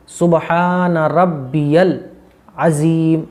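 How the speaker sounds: slow, syllable-by-syllable "सुबहान रब्बियल अज़ीम"; correct pronunciation. Shown as a demonstration. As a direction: stretch the long vowels in "सुबहान रब्बियल अज़ीम". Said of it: "यल है". ("सुबहान रब्बियल अज़ीम" धीरे, खींचकर)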